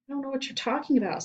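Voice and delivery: fast, high frightened denial; quick scared denial; nervous boy. Acted. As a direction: blurt it out in a panic.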